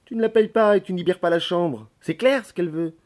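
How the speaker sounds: exasperated maid laying it out; blunt telling-off. Acted. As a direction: spell it out for him impatiently. Maid spells out landlady's complaint.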